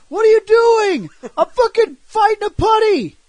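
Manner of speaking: high, loud shouting up to the roof; hammed-up frantic yelling; mock shouted exchange. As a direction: yell back mid-fight.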